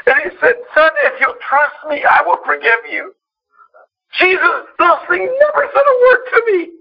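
weep through the words, fighting to keep talking; crying. Real, not acted. Son telling church his drunk father heard God, crying. Pastor retelling father's conversion, weeping.

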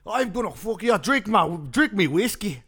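high, loud hammed-up Irish brogue; Irish accent. Host playing McGregor demanding whiskey, loud mock Irish. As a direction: goad like a drunk Irish brawler. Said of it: "should I be McGregor".

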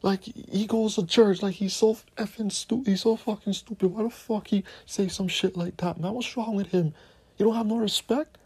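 mocking a shocked believer. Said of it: "Y'all be like".

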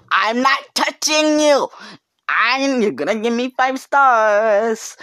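very high, loud mock driver voice; shrill driver impression. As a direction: squeal it like a cartoon creep. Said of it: "He's like".

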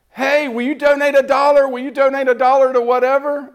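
high, loud chirpy cashier voice; mock sing-song ask at the counter; imitating cashier. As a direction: play the eager fundraising girl. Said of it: "and she's going".

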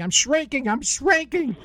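mock yelling. Joking host retells yelling at the psychiatrist, high mock yell. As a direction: play up the panic in a joke, pitched high. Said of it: "I kept yelling".

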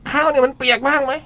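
high voice mimicking the teacher; imitating the teacher. Host imitating the teacher asking about the rice, high. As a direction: ask it like the fussy food teacher. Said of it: "เสียงจากอาจารย์".

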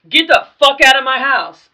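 loud, high furious yell at the intruder; angry yelling. Retold or imitated. Throwing an underage guest out.

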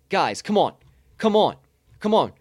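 ordinary talk, nothing marked

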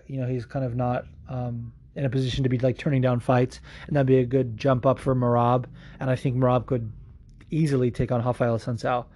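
ordinary delivery

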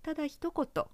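ordinary delivery, nothing marked

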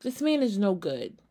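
normal talking voice

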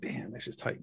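hushed, breathy whisper of awe. Retold or imitated. Telling a friend about the jet video, in an awed whisper. Retelling awed reaction to stealth fighter.

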